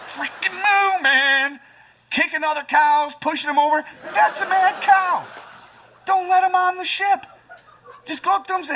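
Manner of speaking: high, loud mock outrage; hammed-up exasperated hollering; ranting comic shout. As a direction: yell it high, worked up over the cow. Acted rant about the mad cow.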